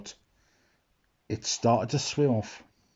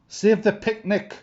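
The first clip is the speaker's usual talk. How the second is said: high, loud alarmed call; hammed-up cook's cry; urgent cry; 9 semitones higher, 4 dB louder than usual. A narrator's reading. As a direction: shout for the floating basket. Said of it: "cried Frizzle".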